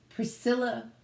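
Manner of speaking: usual speaking voice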